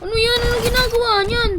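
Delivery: what is that, in disbelief; high startled questions; shocked. Real, not acted. Shocked by opponent.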